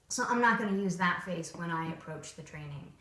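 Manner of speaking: ordinary talk, nothing marked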